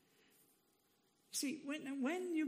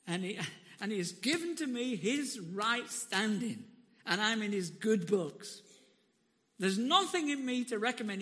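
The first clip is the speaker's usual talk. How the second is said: loud, rising gospel proclamation; full-force preaching; 7 dB louder than usual. Real, not acted. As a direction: preach it out to the whole room.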